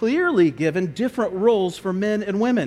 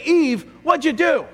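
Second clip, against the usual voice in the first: higher, louder accusing demand; stern rebuke; 8 semitones higher, 3 dB louder than usual. Pretend. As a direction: confront her sharply. God voiced in hypothetical rebuke.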